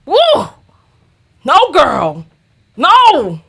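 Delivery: high, loud, emphatic no; sassy refusal. Real, not acted. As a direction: refuse with big, high-pitched indignation. Mock indignant refusal, playing it up.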